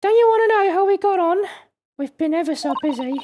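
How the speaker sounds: put-on high, polite young woman voice; young lady voice. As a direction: speak as a prim, proper young assistant. Voices Susato character.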